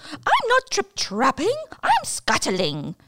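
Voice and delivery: high, small spider voice; little spider voice. Acted. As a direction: squeak it like a tiny spider.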